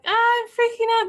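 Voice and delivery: high, panicky mock freak-out. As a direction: squeal it like a nervous mom. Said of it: "I was like".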